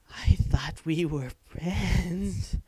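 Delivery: very slow, loud wounded plea; betrayed spider. Pretend. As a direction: drag it out like a heartbroken spider.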